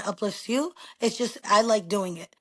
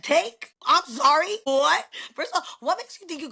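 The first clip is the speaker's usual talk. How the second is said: sharp high disbelieving "Take?"; incredulous retort; 7 semitones higher than usual. Retold or imitated. Mother snapping back at a presumptuous young salesman in disbelief. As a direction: shoot back in offended disbelief.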